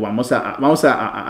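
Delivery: normal talking voice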